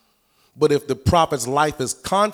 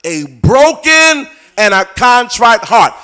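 louder, higher, slow proclaimed line; preaching proclamation; 7 semitones higher, 8 dB louder, slower than usual. Real, not acted. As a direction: proclaim it slowly like a pulpit climax.

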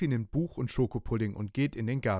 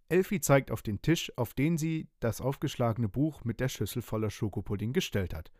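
usual speaking voice

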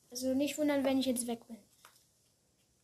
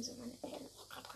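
whispering; 12 dB quieter than usual. Real, not acted. Parents just came in, whispering.

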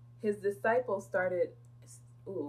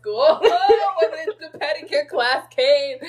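normal talking voice

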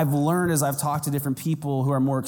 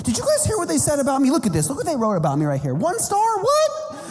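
much higher and louder, fast self-pitying complaint; hammed-up upset whining; 9 semitones higher, 3 dB louder than usual. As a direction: play the immature pastor in a mock meltdown. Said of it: "I'm like".

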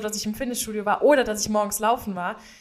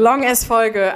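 loud, punchy repeat; loud echo; 7 dB louder, much slower than usual. Real, not acted. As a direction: shout the word back. Echo, much louder.

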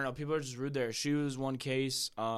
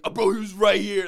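loud, high imitation of an indignant friend; mock mad; 9 semitones higher, 16 dB louder than usual. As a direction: yell it in mock outrage, teasing. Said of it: "you got mad as fuck".

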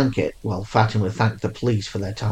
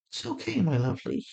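soothing; 9 semitones higher, 9 dB quieter than usual. A narrator's reading. Soothing panicking daughter.

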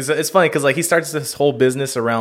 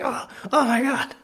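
high, thin pretend agony; squeaky retold "oh my God"; mock pained cry; 12 semitones higher than usual. Kid crying out, hands broken.

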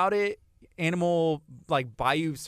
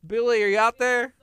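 calling from far away; 6 semitones higher, 4 dB louder than usual. Real, not acted. Calling to guest outside studio.

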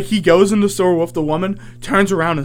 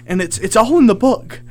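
high, louder, insistent mock ramble; imitated nonstop rambling; 5 semitones higher, 4 dB louder than usual. Woman raves to strangers about a book, imitated ramble. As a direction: run on like a stranger who won't shut up. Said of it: "And then she doesn't shut up".

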